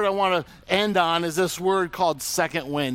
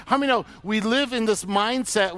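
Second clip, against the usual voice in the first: ordinary talk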